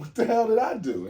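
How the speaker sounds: loud, high-pitched startled outburst; startled protest. Retold or imitated. Acts out startled reaction to cops.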